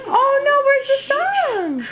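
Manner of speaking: put-on high, singsong toddler talk; reading to a toddler. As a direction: lilt it high for a small child. Parent talking to toddler.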